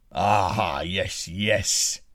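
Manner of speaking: a hint of a big giant chuckling to himself; giant's gloat. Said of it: "said the giant".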